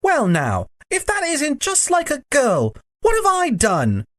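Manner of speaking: put-on high, protesting boy's voice; high-pitched, affronted boy; indignant Diggory. A narrator's reading. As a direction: protest your innocence in a high schoolboy voice.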